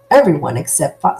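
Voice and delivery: usual speaking voice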